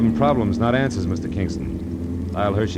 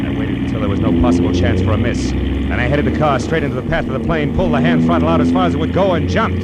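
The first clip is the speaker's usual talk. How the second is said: louder, driving narration building higher; tense raised narration; 4 semitones higher, 7 dB louder than usual. A narrator's reading. Detective narrating his leap from the speeding car, loud and tense. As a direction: push the voice over the roar. Narration over plane roar.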